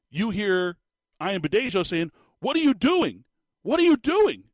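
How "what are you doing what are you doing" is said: high, loud outraged yell; mock screaming. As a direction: yell it like an exasperated commentator losing it on air. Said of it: "He's screaming at the players".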